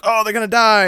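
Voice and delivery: high, gasping "Oh, they're gonna die"; high, mock-worried viewer; mock alarm. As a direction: play a viewer fooled by a fake death. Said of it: "and you're like".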